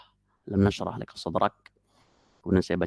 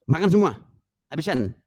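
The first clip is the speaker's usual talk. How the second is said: louder, short fatherly order; bapak membentak; 7 semitones higher, 5 dB louder than usual. Acted. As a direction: command the children like a tiger-voiced dad. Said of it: "Tapi laki keluar dia". (perintah tegas seorang bapak)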